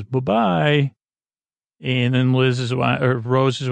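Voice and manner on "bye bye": lilting mock farewell, pitch swooping up; playful goodbye. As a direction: imitate a jokey bye-bye with a wave. Retelling a comic waving goodbye.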